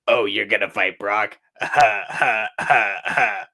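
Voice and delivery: wooden dub line with a forced laugh; stiff line delivery. Retold or imitated. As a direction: read it stiffly like an old cartoon dub. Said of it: "the stiffest line delivery I've ever heard".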